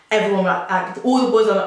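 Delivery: ordinary delivery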